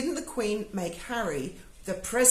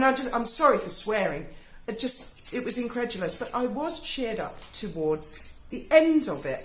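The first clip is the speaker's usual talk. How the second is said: normal talking voice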